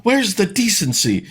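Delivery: high, hammed-up shocked gasping; mock pearl-clutching liberal. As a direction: play a scandalised prude clutching her pearls. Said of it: "clutching their pearls and being like".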